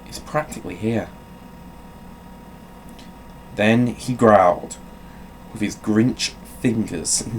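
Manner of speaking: storybook read-aloud voice; playful bedtime story narration; reading to children. Acted. Reads Grinch story aloud as bit.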